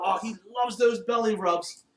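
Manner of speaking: normal talking voice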